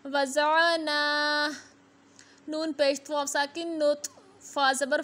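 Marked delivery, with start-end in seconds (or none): 0.1-1.5 वज़ाना with the ना held one alif long; slow recitation, वज़ाना with a long ना; drawn-out vowel. Shown as a demonstration. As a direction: stretch the ना of वज़ाना. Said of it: "एक अलिफ की मिकदार तक खींच कर पढ़ना है".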